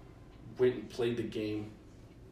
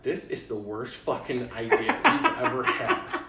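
loud, slow retold complaint through laughter; laughing protest; 2 semitones higher, 7 dB louder than usual. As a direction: protest in disbelief, cracking up.

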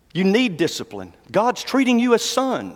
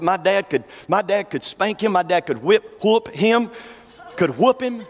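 ordinary talk, nothing marked

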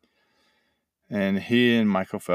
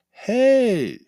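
triumphant; 8 dB louder than usual. Pretend. Surfacing from the pool, triumphant.

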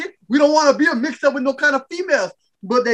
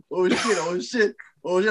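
nervous hushed; 5 semitones lower, 6 dB quieter than usual. Retold or imitated. Theater crowd reacting nervously.

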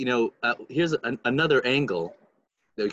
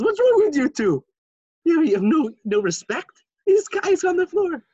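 very high-pitched incredulous rebuke; mock outraged scolding; 5 dB louder than usual. Rebbe rebuking two men dancing beside a sobbing man, outraged. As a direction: scold them in a raised, acted-out voice.

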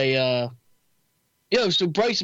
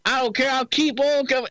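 higher-pitched imitation of a defiant fighter; fighter's mic callout; 9 semitones higher than usual. Imitating a fighter on the mic, high and defiant. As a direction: call out defiantly, pitched well up.

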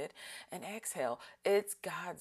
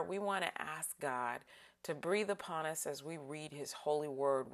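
normal talking voice

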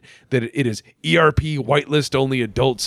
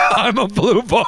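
higher character voice breaking into laughter; laughing imitation; 9 semitones higher, 4 dB louder than usual. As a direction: crack up while mimicking a friend's character. Replaying friend's character, cracking up.

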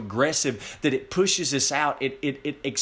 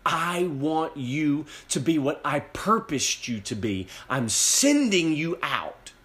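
higher, slow proclamation in God's voice; rousing divine commission; 4 semitones higher, slower than usual. Acted. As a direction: draw the words out, building to a ringing send-off. Acts out God commissioning Paul.

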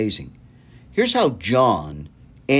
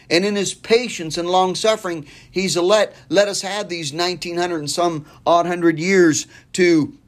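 fervent preaching; 8 semitones higher than usual. Acted. Preacher pleading with radio listeners, in a high urgent preaching voice. Preaching rises to full force.

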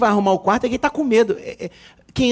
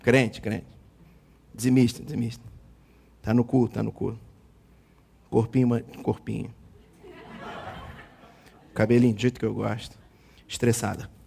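lower, muttered checklist of a man choosing a wife; mock suitor voice; 9 semitones lower, 8 dB quieter than usual. Preacher mimics a man sizing up a bride, low mock voice. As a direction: drop the voice and tick off her qualities. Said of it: "tu queria casar assim".

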